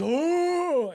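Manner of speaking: a loud, high gasping "Oh!"; gasping cry. Retold or imitated. Cameron bursting to the surface after nearly drowning, loud gasping cry. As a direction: burst up out of the water crying out.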